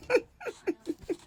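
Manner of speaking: shrieking high laugh; high burst of laughter. Real, not acted. Laughs at Aubrey's quote.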